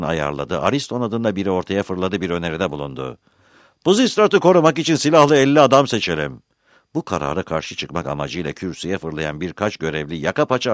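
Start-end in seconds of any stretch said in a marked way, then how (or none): 3.8-6.4 raised, high voice addressing an assembly; loud public proposal. A narrator's reading. Ariston leaping forward to propose bodyguards, calling out. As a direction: call it out to rally the crowd. Planted man's proposal to the crowd.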